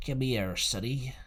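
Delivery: a lightly voiced sly pirate; sly old seaman. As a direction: beckon the boy with a seaman's wheedle. Stranger's voice in story.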